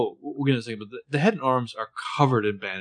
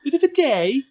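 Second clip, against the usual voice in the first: much higher, louder mock British accent on a bit of a day; 6 dB louder than usual. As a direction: parrot his British phrase in a silly British accent. Mocking Colin's British phrase.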